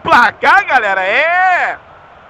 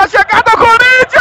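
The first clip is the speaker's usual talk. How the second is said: excited; 7 semitones higher, faster than usual. Real, not acted. Goal chance build-up.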